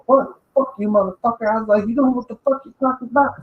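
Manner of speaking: high, loud mock-outraged squawk; indignant retort. Retelling a blow-up at a ranking dude, high indignant outburst. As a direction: fire back in a high, heated imitation. Retold shouting back at a superior.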